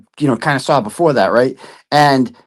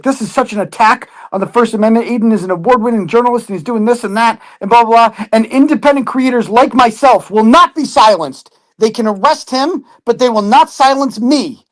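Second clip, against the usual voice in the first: higher, whiny mock streamer voice; mocking impression of her; 5 semitones higher than usual. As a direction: parody her self-important rant. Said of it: "She's like".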